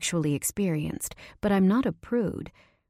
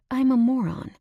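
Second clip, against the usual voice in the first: exasperated, higher and louder; self-scolding; 8 semitones higher, 4 dB louder than usual. Acted. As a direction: berate yourself. Scolding herself.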